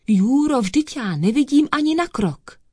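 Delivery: high, worried plea, not truly whispered; anxious whisper. A narrator's reading. Dog stumbling in the dark to the cat, anxious. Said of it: "šeptal úzkostně".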